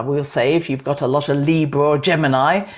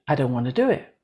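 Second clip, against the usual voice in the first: softer, stubborn little refusal, lifting at the end; reluctant heart; 3 semitones lower, 5 dB quieter, faster than usual. Acted. Heart answering the left brain, quietly reluctant. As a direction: play the inner voice that won't budge. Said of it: "Your heart doesn't want to do it".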